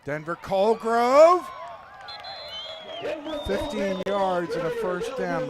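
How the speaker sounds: loud, drawn-out play-by-play call of the name; excited play call. Real, not acted. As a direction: call the runner's name out big over the crowd.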